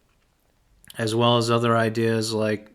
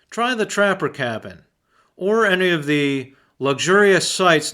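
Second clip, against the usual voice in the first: higher-pitched ad announcer with swooping emphasis; hammed-up radio commercial read, pitched up; 4 semitones higher than usual. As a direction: put on your best sponsor-read announcer voice. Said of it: "So here's my best announcer voice".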